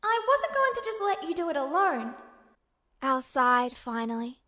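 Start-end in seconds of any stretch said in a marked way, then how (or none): 0.0-2.2 put-on higher boyish voice, lightly wavering; holding back emotion as he explains; higher voice with a hint of waver; Al, a touch emotional. His voice wavered a little bit.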